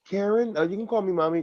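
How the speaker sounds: high, sugary fake-mom voice; mock sweet stepmom. Stepmom sweetly asks the kid to call her mommy. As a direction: coo it like a stepmom overdoing it. Mocking a too-quick stepmom line.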